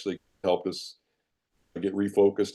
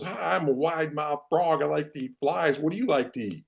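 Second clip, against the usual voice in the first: wide mouth frog; 5 semitones higher, 3 dB quieter than usual. Retold or imitated. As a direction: play the cheerful frog introducing himself, unhurried. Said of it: "and he goes".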